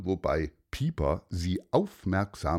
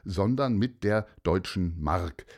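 plain reading voice

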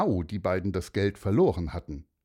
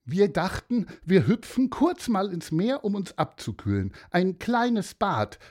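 put-on higher girl's voice, sheepish; girl explaining; 9 semitones higher than usual. Girl confesses to the old man they went swimming, sheepish. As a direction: admit it like a guilty nine-year-old. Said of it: "sagte Leni".

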